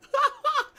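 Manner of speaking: high loud laugh; burst of laughter. Real, not acted. Laughing at his own retold outburst, high and loud. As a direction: laugh out at the memory.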